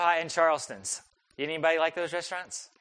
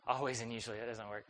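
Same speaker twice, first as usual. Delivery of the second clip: low, much softer aside; muttered self-correction; 6 semitones lower, 11 dB quieter, faster than usual. Real, not acted. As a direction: drop it down, as if talking to yourself. Catches own wording slip, aside.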